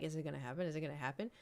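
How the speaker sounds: low, soft anticipation; hushed inner voice. Retold or imitated. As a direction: murmur it to yourself in suspense. Voicing her own anxious inner thought.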